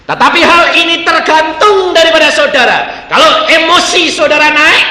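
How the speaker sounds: loud, high, slowing on key words; forceful preaching. Acted. Preacher exhorting the congregation loudly. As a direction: proclaim it with full conviction.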